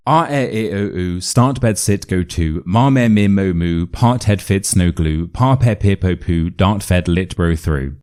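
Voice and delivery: quick rhythmic run of sounds on one breath; rapid vowel drill. Shown as a demonstration. Coach showing students a shadowing drill, rapid one-breath run. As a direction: rattle off the vowels and word pairs in a row. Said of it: "say these as many times as you can in a row".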